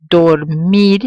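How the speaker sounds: dormir, leaning on MIR; dormir with a firm last syllable; final-syllable stress. Shown as a demonstration. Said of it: "stress will fall on the last syllable".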